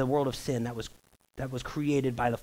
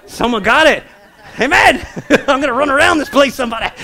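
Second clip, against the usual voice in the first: excited shout; 10 semitones higher, 14 dB louder, faster than usual. Real, not acted. Congregation responds, preacher erupts.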